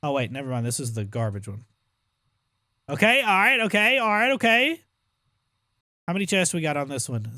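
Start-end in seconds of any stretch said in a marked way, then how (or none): 2.9-4.8 excited hype. Real, not acted. Hyping himself up loudly.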